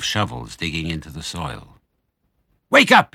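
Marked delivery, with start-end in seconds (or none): 2.7-3.1 high, loud alarm shout. Acted. Mister Fox waking his family as diggers come, loud shout. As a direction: yell to wake the family. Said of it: "he shouted".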